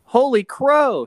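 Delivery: high, loud, slow gasped holy crow; dramatic gasp. Acted. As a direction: gasp it out in shock. Reading gasped line dramatically.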